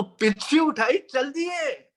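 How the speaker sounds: retold ringing rejoinder; acharya's retort. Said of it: "ये जो शब्द है ये गूंजता है".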